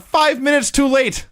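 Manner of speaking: high, drawn-out call, only mildly shouted; mock announcer shout. Co-host retells an announcer's interjection at Goldberg's arrival, mock shouting. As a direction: imitate a commentator calling out, slowly and high. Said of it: "one of the announcers just shouts out".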